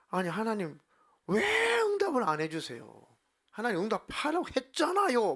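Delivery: imitated high-pitched wail of complaint; hammed-up demand for an answer; protesting prayer. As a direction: stretch "왜" high and loud, pleading angrily. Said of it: "막 이러면서".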